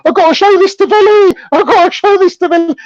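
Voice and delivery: high, excited mocking impression; gleeful mock voice. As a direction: squeal it like a giddy snitch. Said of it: "rubbing his hands going".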